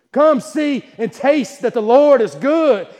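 loud, high, slow preaching cry; full-force preaching. Acted. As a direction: call them in at the top of the voice.

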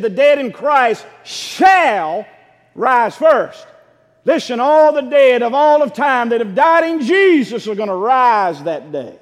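loud, high, slow declaration; full-force preaching. Acted. Preacher proclaiming the rising of the dead, full force. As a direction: drive home the resurrection.

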